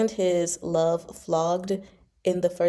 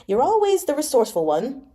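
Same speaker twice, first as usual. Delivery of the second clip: delighted; 7 semitones higher, faster than usual. Acted.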